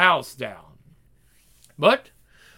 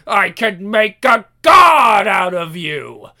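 shout; 3 semitones higher, 8 dB louder than usual. Acted. Demon offers power, shouting.

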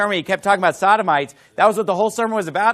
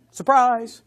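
higher-pitched mock-surprised exclamation; mock surprise; 4 semitones higher than usual. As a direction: feign surprise at the big reveal. Sarcastic reveal about the pastor.

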